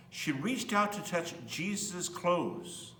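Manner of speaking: plain reading voice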